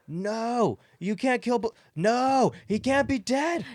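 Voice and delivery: high-pitched hammed-up wailing; anguished denial. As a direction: cry out in melodramatic disbelief, voice way up. Dwayne learns his brother is dead.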